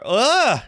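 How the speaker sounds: sharp high loud ugh of a fall; pained grunt on falling. Acted. Hero tumbling out of bed: loud pained grunt. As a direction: yelp as you hit the floor.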